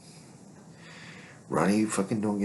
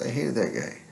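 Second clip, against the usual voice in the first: ordinary delivery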